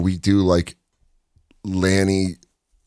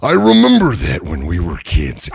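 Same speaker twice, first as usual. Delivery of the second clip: slow raspy hint of Macho Man; lightly voiced Macho Man; 5 semitones lower than usual. A hint of Macho Man reminiscing to his brother, slow. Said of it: "calling him every night going like".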